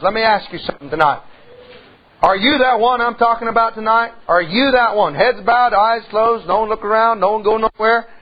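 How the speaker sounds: preacher calling sinners forward at the invitation; altar call. Acted. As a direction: urge them to come forward.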